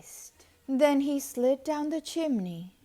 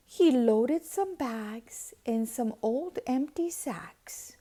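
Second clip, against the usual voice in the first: ordinary delivery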